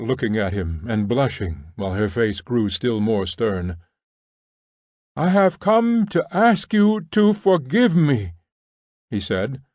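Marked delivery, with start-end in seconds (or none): 5.2-8.3 higher and even, like a lesson learnt by heart; loud but monotonous. A narrator's reading. Nekhludoff reciting his apology loudly, by rote. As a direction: recite it loud without colour. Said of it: "in a loud but monotonous voice".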